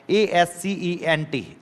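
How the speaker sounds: letters spelled out slowly; slow spelling. Shown as a demonstration. Teacher spelling ascent slowly for students. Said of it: "इसको accent कहा जाता है".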